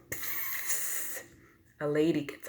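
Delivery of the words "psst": soft whispered psst; bus brakes hiss. A narrator's reading. Teacher imitates the bus stopping, soft hiss. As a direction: let out a soft hiss of air. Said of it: "The bus stops".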